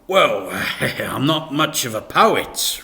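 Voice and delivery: awkward chuckle, dodging; nervous laugh. A narrator's reading. Dad wriggling out of reading a poem, laughing nervously. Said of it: "replied Dad".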